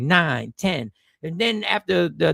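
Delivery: ordinary talk, nothing marked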